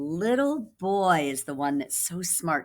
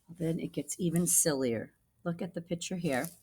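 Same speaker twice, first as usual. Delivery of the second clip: softer, conspiratorial aside; hushed; 6 dB quieter than usual. Real, not acted. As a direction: lean in and hush. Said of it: "hushed aside to children".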